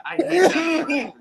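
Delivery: loud, high, heated rant; fed-up fan yelling; angry outburst. Real, not acted. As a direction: blurt out real anger at the storyline. Angry rant about brother feud.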